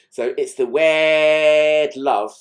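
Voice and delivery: slow, loud hammed-up Scouse accent. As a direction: stretch out a Liverpool Scouse drawl. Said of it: "really hamming up the Scouse accent".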